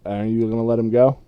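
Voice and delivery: normal talking voice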